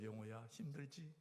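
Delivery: a lightly voiced tender God; gentle voice of God. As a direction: speak slowly as a kind God. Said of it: "하나님께서".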